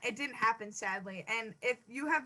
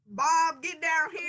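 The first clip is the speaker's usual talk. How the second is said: yelling across the room; 5 semitones higher, 4 dB louder, slower than usual. Pretend. Calling a kid downstairs.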